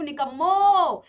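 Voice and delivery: loud, drawn-out teasing rebuke; long, loud mock telling-off; mock scolding. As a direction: playfully scold lazy pupils, stretching the word. Teacher mock-scolds the class.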